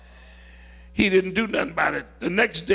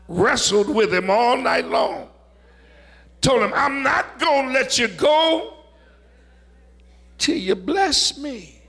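higher, emphatic pulpit voice; impassioned preaching; 5 semitones higher, 3 dB louder than usual. Acted. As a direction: preach it with defiant resolve. Preaches Jacob's refusal to let go.

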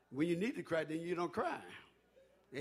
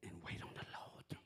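hushed breathy whisper, lower; whispered aside to the flock; 10 semitones lower, 15 dB quieter than usual. Acted. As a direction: breathe it out like a secret promise. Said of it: "whispered sermon line".

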